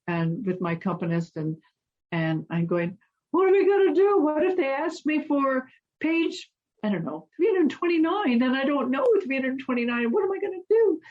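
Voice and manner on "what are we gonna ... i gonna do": retold panic. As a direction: replay your own panic, voice jumping up high. Panicked retold plea, driving to contest.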